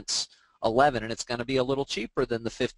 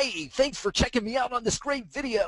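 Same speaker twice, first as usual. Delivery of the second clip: very high-pitched, fake-peppy host greeting; hammed-up high squeal of a greeting, as a bad example; overdone video voice; 11 semitones higher than usual. Shown as a demonstration. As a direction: gush it way up high, mock over-eager presenter. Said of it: "In a higher tone, you want your volume to be up a little bit, but you don't wanna".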